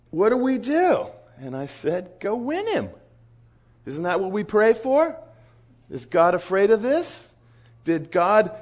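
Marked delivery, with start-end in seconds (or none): 0.1-1.1 high, pleading mock worry; Wes's worried voice. Missionary asking his leader what to do, high mock worry. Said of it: "And so Wes says". 2.2-8.5 high, incredulous questions building louder; rousing challenge. Retold or imitated. Leader pushing worried missionary to go, rousing challenge. Acted exhortation to worried colleague.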